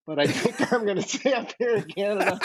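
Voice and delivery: high, laughing through the words; laughing retelling. Retold or imitated. Father quoting his daughter's note turning him down, laughing. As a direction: crack up while quoting the punchline.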